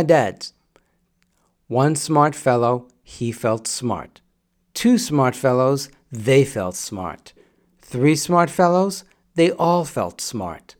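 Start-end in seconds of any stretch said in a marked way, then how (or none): 1.7-10.7 slow, deliberate tongue twister; slow careful recitation. Shown as a demonstration. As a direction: say each phrase slowly and carefully. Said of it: "you say it slowly for one reason".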